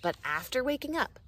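ordinary delivery

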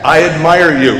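ordinary talk, nothing marked

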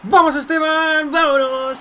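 loud high goal celebration; excited cheering. Real, not acted. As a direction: yell it out, thrilled. Cheering his striker's goal.

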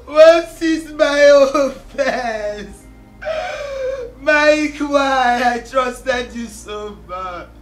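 tearful wail swelling into loud cries; crying plea. Acted. Betrayed woman asking Mike why, crying plea. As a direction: cry out in betrayed anguish.

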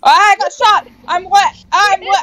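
high, loud startled cry; real shock at being hit; alarmed yell. Real, not acted. Getting hit in game.

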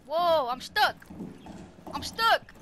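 high, loud panicked call; panicked yelling. Real, not acted. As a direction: yell for help, stuck and panicking. Panicked gamer stuck in corner.

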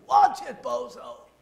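high mock yell at a driver; yelling out the window. Son at a driver who cut in, mock yell. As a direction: imitate an outraged yell from a car. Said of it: "Stephen yells out the window".

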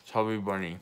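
talking through stuffed cheeks; mouth full. Real, not acted. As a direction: say it with a mouthful of marshmallows. Chubby bunny game, marshmallows in mouth.

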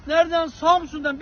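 ordinary delivery

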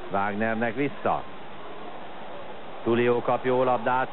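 usual speaking voice

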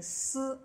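斯 as a hissed s with no voice; 斯 unvoiced, like Italian s; voiceless s. Shown as a demonstration. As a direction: say 斯 as a voiceless s sound. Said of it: "清辅音斯和浊辅音z".

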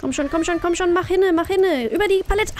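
high, loud frantic urging; urgent urging. Real, not acted. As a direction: hurry yourself on, tense. Urging herself during chase.

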